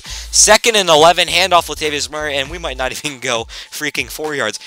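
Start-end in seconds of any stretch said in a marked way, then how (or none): none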